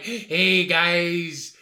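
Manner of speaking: first rough stab at a Mexican accent; mock Mexican accent. Shown as a demonstration. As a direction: badly imitate a Mexican accent. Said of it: "the, the accent. You're just not like".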